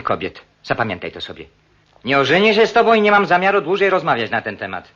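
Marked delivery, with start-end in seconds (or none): 2.0-4.9 high, loud furious refusal; husband angrily refuses to marry her; angry. Acted. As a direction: slam the door on the subject.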